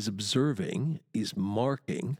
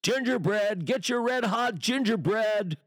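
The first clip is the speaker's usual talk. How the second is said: high, carrying street-seller call; sing-out vendor's call, pitched way up; street hawker's cry; 10 semitones higher than usual. Acted. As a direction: cry your wares across the market. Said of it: "they're the cries of street traders, hawkers".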